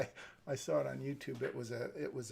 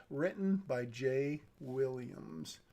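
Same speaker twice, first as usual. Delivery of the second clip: usual speaking voice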